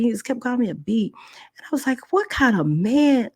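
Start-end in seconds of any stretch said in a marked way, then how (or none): 2.1-3.3 high and slow, disgusted; outraged. Retold or imitated. Girl to the hypocrite man, high outraged scorn. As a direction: spit out the question in outrage. Confronting the attacker.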